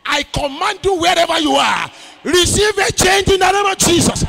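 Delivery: high, booming shouted command to the congregation; shouted pulpit proclamation; full-force preaching. Real, not acted. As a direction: thunder out a prophetic declaration, drawing out the words.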